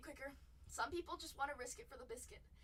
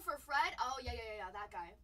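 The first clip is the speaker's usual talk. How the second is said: usual speaking voice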